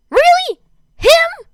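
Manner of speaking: squeaked very high and loud. Acted. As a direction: shriek it in shocked disbelief. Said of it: "he squeaked".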